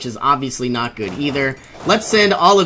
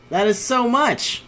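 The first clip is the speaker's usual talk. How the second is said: high, dismayed repeat; high disbelief; 8 semitones higher than usual. Real, not acted. Gamer losing territory, high disbelieving moan. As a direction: pitch up on the repeat.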